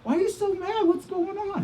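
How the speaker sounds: very high, upset little-daughter voice; imitated crying child. Daughter asking her dad why he is mad, imitated tearful pleading. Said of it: "my daughter Misha starts crying".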